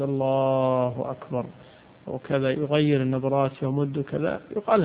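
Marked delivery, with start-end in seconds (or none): 0.0-1.4 الله أكبر stretched slow like some imams; slow chanted الله أكبر with long vowels; imam's drawn-out takbir. Retold or imitated. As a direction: mimic an imam dragging الله. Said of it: "بعض الأئمة يمد".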